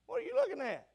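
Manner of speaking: high, put-on voice of a puzzled listener; mimicking a congregant. Preacher imitating a churchgoer questioning him, in a high mock voice. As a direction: play the curious churchgoer teasingly. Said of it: "You said, "Brother Billy".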